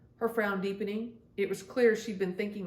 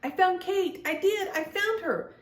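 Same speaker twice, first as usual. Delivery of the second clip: excited shout; 11 semitones higher than usual. Acted. Little Gabby calls up to the fairies, thrilled shout. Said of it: "what Gabby was shouting".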